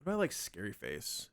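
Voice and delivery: usual speaking voice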